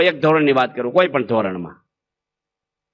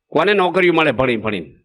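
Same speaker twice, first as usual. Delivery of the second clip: ordinary delivery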